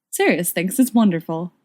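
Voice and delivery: ordinary delivery, nothing marked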